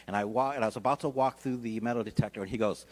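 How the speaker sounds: normal talking voice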